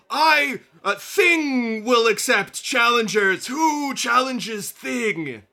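high, loud and slow creature call; loud challenge. Acted. Thing calling the audience out to challenge him, loud. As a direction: boom out a dare to the audience.

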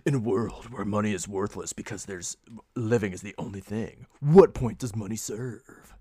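deep dramatic trailer narrator; movie trailer voice. Pretend. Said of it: "to do my movie trailer voice".

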